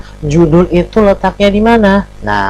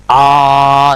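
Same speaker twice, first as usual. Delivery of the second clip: loud sustained "Ah"; loud, ringing; 5 dB louder than usual. Shown as a demonstration. Teacher showing pupils what "nyaring" means, loud and ringing. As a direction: let out a held, ringing cry. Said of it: "Suaranya keras".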